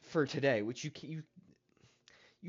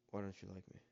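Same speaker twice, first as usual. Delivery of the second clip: much softer and lower, a mumbled plea; super low voice; 6 semitones lower, 17 dB quieter, much faster than usual. Retold or imitated. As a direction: whine it almost under the breath. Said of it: "super low vo".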